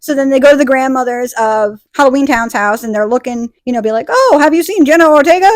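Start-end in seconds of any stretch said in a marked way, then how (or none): 4.1-5.5 higher, louder put-on asking; acted inquiry. Vampires ask grandmother about the missing girl, raised acted voice.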